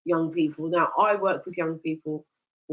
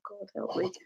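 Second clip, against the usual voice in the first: whispered plea; 3 semitones higher, 9 dB quieter than usual. Retold or imitated. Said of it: "recalling climb exhaustion".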